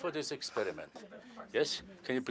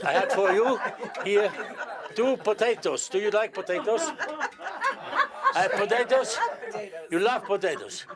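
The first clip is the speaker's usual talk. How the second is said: amused and chuckling; 7 semitones higher than usual. Real, not acted. Magician chuckling over potatoes.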